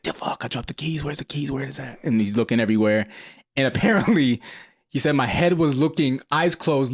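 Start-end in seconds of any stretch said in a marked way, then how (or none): 0.0-2.0 quiet, hushed searching for keys; muttering brother. Retold or imitated. Brother who dropped keys in the grass, muttering quietly. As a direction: mutter to yourself hunting for lost keys. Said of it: "He's like".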